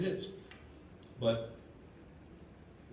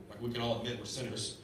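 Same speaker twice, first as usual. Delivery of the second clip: ordinary talk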